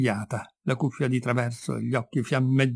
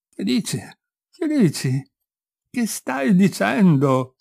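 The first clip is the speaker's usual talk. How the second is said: higher, slow, faltering question; stammered, trembling; 7 semitones higher, 3 dB louder, slower than usual. A narrator's reading. Father stunned by the revelation, slow trembling stammer. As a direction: let the words shake out slowly. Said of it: "balbettò, con voce spenta e tremula".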